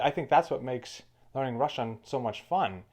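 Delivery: ordinary talk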